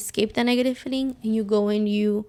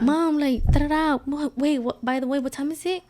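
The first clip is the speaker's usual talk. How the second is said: higher-pitched, scattered self-imitation; super talkative; 5 semitones higher than usual. Asking mom the time while high, super talkative. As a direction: chatter and interrupt yourself, pitched up. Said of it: "I would be super talkative".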